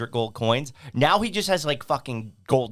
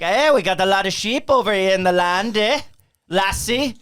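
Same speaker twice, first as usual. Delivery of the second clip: mock Irish accent; 6 semitones higher, 6 dB louder than usual. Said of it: "I was doing my Irish accent".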